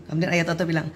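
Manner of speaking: ordinary delivery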